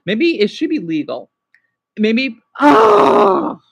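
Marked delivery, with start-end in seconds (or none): 2.6-3.6 loud, high scream of frustration; real high-pitched cry of frustration; frustrated scream. Real, not acted. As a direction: let out a loud exasperated yell. Frustrated outburst mid-rant.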